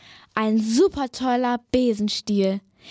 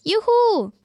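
cheering; 10 semitones higher than usual. Acted. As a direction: cheer as the broom takes off. Witch cheering on takeoff.

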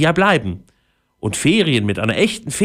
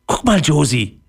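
excited call; 5 semitones higher than usual. A narrator's reading. Boy spots mushroom, calls sister excitedly. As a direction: point it out with glee. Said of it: "rief Leon aufgeregt".

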